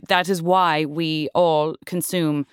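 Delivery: normal talking voice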